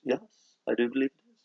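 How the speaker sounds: softly spoken put-on British accent. Host confirms the first British candy, British accent. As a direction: answer as a polite Brit. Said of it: "how they would mock my British accent".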